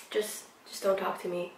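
very soft imitated dismissal; quiet, imitated sulk; sulky brush-off. Retold man sulking off.

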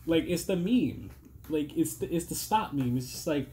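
ordinary delivery

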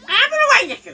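high, loud taunting jeer; cocky high-pitched dare; mocking taunt. Real, not acted. Taunting enemy mid-fight.

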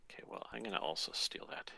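hushed aside; whispering. Real, not acted. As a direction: whisper it to yourself. Whispering a sneaky move.

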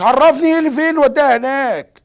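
loud, fed-up protest; exasperated outburst. Acted. Protesting to the lost girl's mother, exasperated and loud. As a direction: burst out in frustration at the caller.

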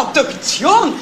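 sharp high cry of alarm; shocked disbelief. Acted. Sister hearing her brother will be autopsied, shocked high outcry. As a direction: recoil at the very word.

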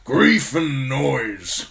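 put-on slow, loud, high old man's grumble; grumbling old commander. A narrator's reading. Commander fed up with his noisy raven, slow grumble. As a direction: draw it out in loud irritation. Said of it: "Mormont grumbled".

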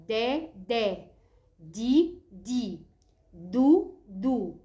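each of دا، دي، دو said twice, long vowel, rising then falling; letter sound drill. Shown as a demonstration. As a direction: drill دا، دي، دو in separate drawn-out pairs. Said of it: "هنقوله بقى".

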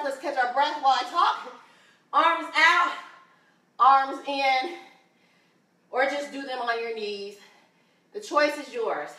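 ordinary talk

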